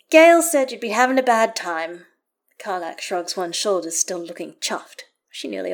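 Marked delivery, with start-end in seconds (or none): 0.1-2.1 raised, loud, cheery explanation; hearty character voice. A narrator's reading. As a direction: speak up heartily as the visiting friend. Karlach explains visit, voiced loudly.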